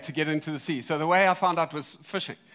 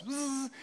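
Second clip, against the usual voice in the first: high, quick buzz of fishing line; imitated reel whirr; 9 semitones higher, 4 dB quieter than usual. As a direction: mimic the rod buzzing as line runs out. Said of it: "So then my rod goes".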